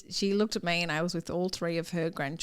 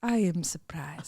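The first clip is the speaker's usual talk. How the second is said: put-on slow Polish-accented repeat; slower than usual. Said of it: "this is where I do the accent".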